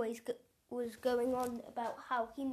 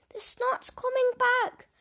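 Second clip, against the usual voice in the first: high, louder panicked yell; 10 semitones higher, 6 dB louder than usual. A narrator's reading. Teen yelling as the snot flies back. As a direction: shout the warning in alarm. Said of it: "yelled Jimmy".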